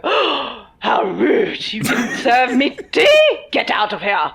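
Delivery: high, loud scandalized mock outrage; hammed-up shocked indignation; mock outraged posh guest. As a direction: play the offended host, overdoing it.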